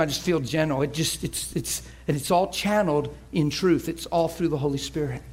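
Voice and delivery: normal talking voice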